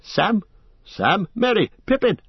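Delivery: loud high frantic calls; wild shouts into the gloom; shouting wildly. Acted. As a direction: yell the names in rising panic. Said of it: "shouting wildly".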